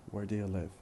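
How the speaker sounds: "Where do you live?" linked, very weak do; fast connected speech. Shown as a demonstration. Teacher pronounces examples for students, in fast connected speech. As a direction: run "Where do you live?" together, do and you unstressed. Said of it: "and then in fast speech".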